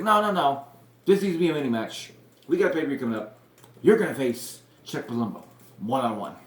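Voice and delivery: high and loud, barking orders; bossy GM shouting over the crowd; commanding shout. Retold or imitated. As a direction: boss putting his foot down. Bischoff stopping the fight.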